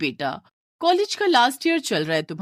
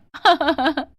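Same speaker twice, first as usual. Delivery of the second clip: teasing laugh; 6 semitones higher, 5 dB louder than usual. Acted. Sister laughing at brother.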